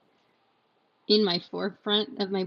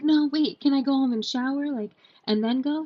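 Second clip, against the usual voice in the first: urgent plea; 7 semitones higher, 5 dB louder than usual. Retold or imitated. Begging doctor to go home first.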